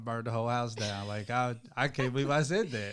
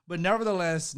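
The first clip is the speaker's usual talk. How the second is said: ordinary talk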